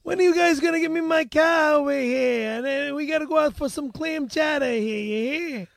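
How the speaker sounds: hammed-up Boston accent. Asking the guys for his car, Boston accent. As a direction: demand it in put-on Boston speech. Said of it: "we could do a little Beantown Heat".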